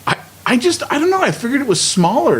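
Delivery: high, amazed disbelief; genuinely shocked, pitch way up; surprised. Real, not acted. Reacts to ten billion figure.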